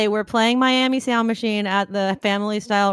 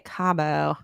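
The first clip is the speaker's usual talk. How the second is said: 1 mock Cabo; 5 semitones lower than usual. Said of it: "as they say".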